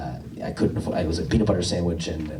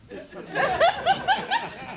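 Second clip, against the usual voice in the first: laughing. Real, not acted. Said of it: "laughing drawn out".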